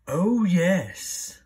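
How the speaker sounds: low, loud proud dragon. Acted. Dragon proudly answering the princess, low and loud. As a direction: boast like a big proud dragon.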